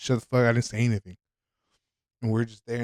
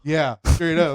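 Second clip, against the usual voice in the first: normal talking voice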